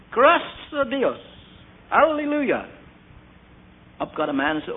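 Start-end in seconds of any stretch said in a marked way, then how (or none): 0.1-2.7 high, slow joyful cry of thanks; joyful praise. Retold or imitated. Man at prayer meeting rejoicing over news, high joyful cry. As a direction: lift it up in praise.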